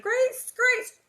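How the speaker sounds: put-on high, loud shout for the child. A narrator's reading. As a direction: yell her name across the prairie. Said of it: "He shouted".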